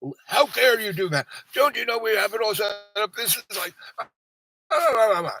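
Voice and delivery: hammed-up staff telling him off; squeaky caricature of an offended clerk; mock outrage. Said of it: "And they're like".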